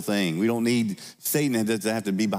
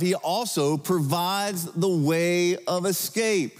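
slow, higher, drawn-out proclamation; emphatic; 8 semitones higher, slower than usual. Acted. Pastor proclaiming the promise to congregation, slow and emphatic. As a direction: stretch out the way of escape.

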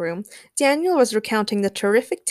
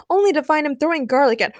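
quicker, higher and louder; hurried recounting; 7 semitones higher, 6 dB louder, faster than usual. A narrator's reading. Spider-Man explains about the garlic thrower, hurried. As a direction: rattle it off until cut short.

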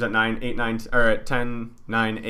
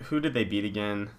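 ordinary talk, nothing marked

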